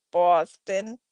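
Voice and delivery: a quick Boston accent on "Boston", the vowel rounded and the r dropped. Shown as a demonstration. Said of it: "I can do Boston".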